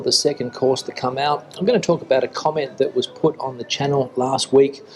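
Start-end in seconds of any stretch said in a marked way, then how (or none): none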